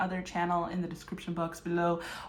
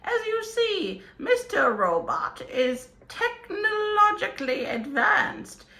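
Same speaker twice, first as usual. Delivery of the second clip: put-on high theatrical old wizard; grand storybook wizard greeting; 5 semitones higher than usual. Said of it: "your wizardy companion".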